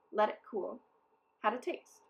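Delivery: usual speaking voice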